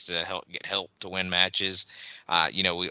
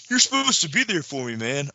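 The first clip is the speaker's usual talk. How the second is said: much higher whiny plea; mock teen soap whine; 8 semitones higher than usual.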